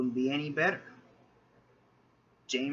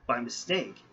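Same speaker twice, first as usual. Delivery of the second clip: usual speaking voice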